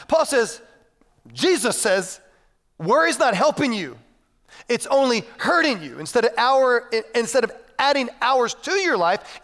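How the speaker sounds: high, insistent rhetorical questions; driving, emphatic sermon voice; forceful preaching. Real, not acted.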